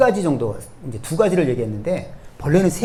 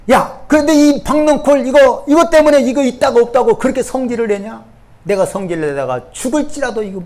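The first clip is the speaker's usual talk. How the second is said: scolding shout; 8 semitones higher, 6 dB louder than usual. Acted. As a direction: call him out sharply, pitched up high. 하나님과 요나의 말다툼 재연. (높고 크게 꾸짖는 소리)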